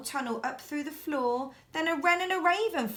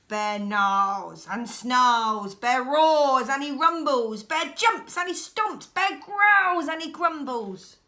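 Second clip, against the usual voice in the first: louder, slow, growly build of angry bear; loud narration; 8 dB louder, slower than usual. A narrator's reading. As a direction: boom it out like the bear stomping.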